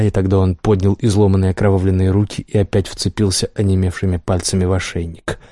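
ordinary delivery, nothing marked

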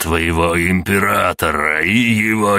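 slow, menacing hoarse threat; hoarse rasp. Acted. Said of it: "прохрипел".

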